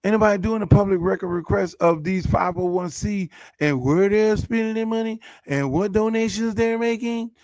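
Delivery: ordinary talk, nothing marked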